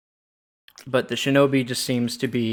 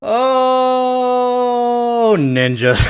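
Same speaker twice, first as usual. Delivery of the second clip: loud high excited yell; excited shout; 12 semitones higher, 8 dB louder, much slower than usual. Acted. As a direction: burst out shouting, then laugh. Bit opens with a yell.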